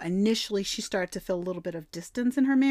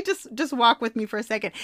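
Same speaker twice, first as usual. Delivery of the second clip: ordinary delivery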